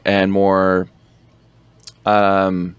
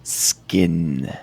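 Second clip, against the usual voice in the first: "skin" stretched out on its opening sound; drawn-out word; 4 semitones lower, 3 dB quieter than usual. Retold or imitated. Imitating the Hobo's delivery for a co-host, drawn-out "skin". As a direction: linger on "skin" as the film character does. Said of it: "the way it's, he just says".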